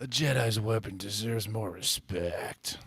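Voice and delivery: slow, very soft whispered lament; dismayed whisper. Acted. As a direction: whisper it as if pained by a bad film line. Mock film line, then dismayed whisper.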